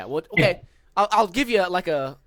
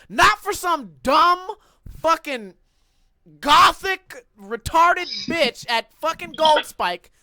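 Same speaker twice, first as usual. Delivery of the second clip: louder, high and slow, fuming; angry rant; 7 semitones higher, 6 dB louder, slower than usual. Real, not acted. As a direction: spit out the insults slowly. Ranting about the slapper.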